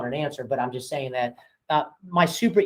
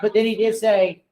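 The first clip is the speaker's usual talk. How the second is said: says it through a real laugh, a bit louder; laughing as he tries to go on; 5 semitones higher, 5 dB louder than usual. Real, not acted. Laughing broker to the room, trying to finish the story.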